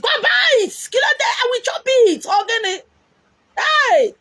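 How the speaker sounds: high, loud yelled order; shouting demand. Retold or imitated. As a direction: bellow at him to go buy it. Shouting at son to buy.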